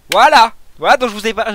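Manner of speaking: goal cheer. Real, not acted. Gamer cheering his team's header goal to viewers, loud and excited. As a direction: erupt as the header goes in. Goal celebration during match commentary.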